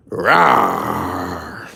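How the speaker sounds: low playful growling "rawr"; mock lion roar. Teasing the self-proclaimed lion, low playful roar. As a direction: growl it like a lazy pretend lion.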